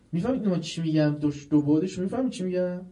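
ordinary talk, nothing marked

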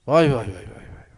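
loud grieving cries of vay; anguished wail. Real, not acted. Preacher wailing loudly to the crowd. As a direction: wail out the grief.

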